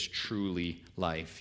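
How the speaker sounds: slow, weighted truly; stress on truly. Real, not acted. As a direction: lean long on truly. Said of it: "You can't escape that word truly".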